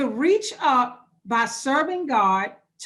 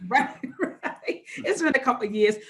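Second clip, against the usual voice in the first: amused, laughing through the words. Real, not acted. Runner admits those days are past, laughing. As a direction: chuckle at your own admission. Joking about former running days.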